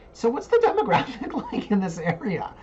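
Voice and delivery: high-pitched, giggly imitated question; imitated client. Client asking about the neighbourhood, laughingly imitated. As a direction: ask it high, through laughter. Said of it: "My client was like".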